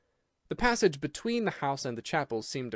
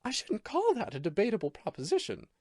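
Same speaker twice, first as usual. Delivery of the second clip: higher, lightly mocking; cool ironic reply; 9 semitones higher than usual. A narrator's reading. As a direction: answer coolly, amused.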